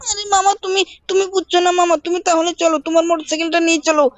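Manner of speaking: put-on high, pleading little-boy voice; Shinchan child voice. Shinchan nagging his uncle, high childish voice. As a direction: pitch way up and whine at mama like a small kid.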